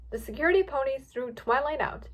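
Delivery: ordinary delivery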